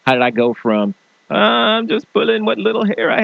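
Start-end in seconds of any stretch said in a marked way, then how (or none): 1.3-3.2 high mock frazzled voice; exasperated quote. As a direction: play yourself pulling your hair out.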